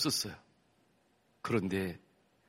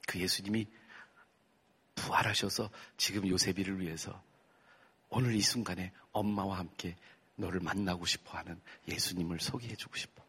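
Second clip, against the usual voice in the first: soft, gentle motherly voice; tender mother; 5 dB quieter than usual. Retold or imitated. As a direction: comfort the crying son softly. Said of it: "엄마가". (우는 아들을 달래는 부드러운 엄마 목소리)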